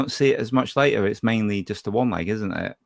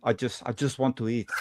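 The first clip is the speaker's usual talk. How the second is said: softly delivered, half-hearted movie star impression; bad Van Damme impersonation; 6 dB quieter than usual. Co-host repeating the line as a clue, soft bad impersonation. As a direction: quote the film line softly in a poor impersonation. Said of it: "a really shitty impersonation".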